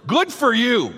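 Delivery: high, loud mock praise; sarcastic tone. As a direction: say it as a pointed put-down. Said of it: "you can hear the tone in his voice".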